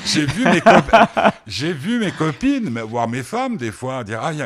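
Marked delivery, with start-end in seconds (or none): 0.0-2.7 high laughing tease. Real, not acted. Host teasing the guest about his female fans, laughing. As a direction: laugh out the words, slowly.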